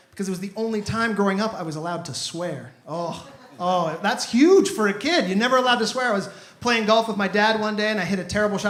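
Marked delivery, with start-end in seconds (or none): none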